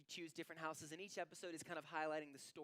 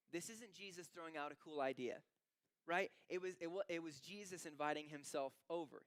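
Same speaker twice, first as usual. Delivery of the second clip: usual speaking voice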